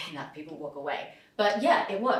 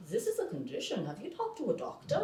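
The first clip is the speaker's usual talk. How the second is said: detached German; 2 semitones higher, 7 dB quieter than usual. Retold or imitated. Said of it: "he was being so detached".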